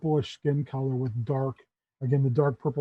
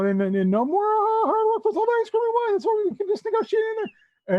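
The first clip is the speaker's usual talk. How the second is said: higher, stammering plea climbing into a squeal; panicking goblin; 7 semitones higher, 3 dB louder than usual. Acted. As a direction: babble and bargain, pitch rising as panic builds. Goblin pleads with hungry ogre.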